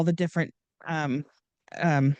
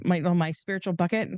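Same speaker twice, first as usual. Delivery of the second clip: normal talking voice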